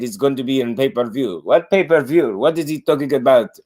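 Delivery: mock Eastern European accent, puzzled; Loma's accent. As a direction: play Loma confused by the offer. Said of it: "And then he said".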